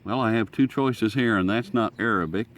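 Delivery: ordinary delivery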